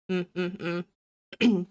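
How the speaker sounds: ordinary talk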